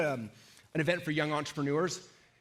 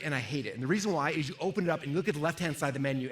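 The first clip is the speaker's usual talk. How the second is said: ordinary delivery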